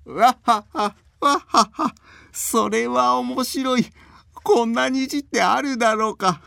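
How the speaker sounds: put-on high hearty old man laughter; belly-laughing old man. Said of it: "腹を抱えて笑っている".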